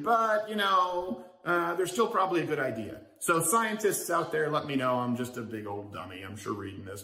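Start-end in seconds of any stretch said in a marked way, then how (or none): 0.0-3.0 mock the limp conclusion, stretched and higher; mocking the study's hedge. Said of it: "And then in conclusion".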